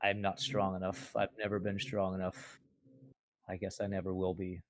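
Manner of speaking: soft, resigned admission of weakness; defeated. Acted. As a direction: quietly accept defeat. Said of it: "laying there in defeat".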